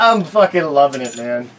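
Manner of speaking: loud and delighted; gleeful. Real, not acted. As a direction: crow about it loudly.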